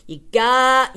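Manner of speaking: usual speaking voice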